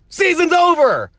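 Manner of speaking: high-pitched, upset declaration; exasperated outburst. Real, not acted. Fan declaring the season lost after the injury, exasperated. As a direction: cry it out in real disbelief.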